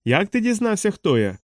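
high-pitched exclaimed question, no real volume; mild shout. A narrator's reading. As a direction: cry out the question, starting high. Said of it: "закричав чоловік". (стриманий вигук-запитання)

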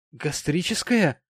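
frightened lady's exclamation; frightened cry. A narrator's reading. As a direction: cry it out in alarm, drawn out. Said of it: "вскрикнула испуганная миссис Тоджерс".

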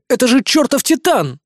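high, loud alarmed shout; yelling. Acted. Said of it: "заорал я".

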